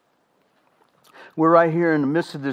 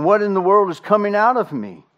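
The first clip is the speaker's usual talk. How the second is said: higher, louder cry of disbelief at yourself; exasperated outburst; 6 semitones higher, 5 dB louder than usual. Retold or imitated. Frustrated parent at wits' end, exasperated outburst. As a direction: throw up your hands: what is coming out of me. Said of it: "you'll find yourself going".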